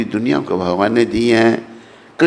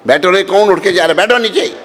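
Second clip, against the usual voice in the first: higher, quick call to sit down; playful scolding call; 5 semitones higher, 3 dB louder, faster than usual. Real, not acted. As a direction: call across the hall to stop someone leaving. Calling to someone leaving.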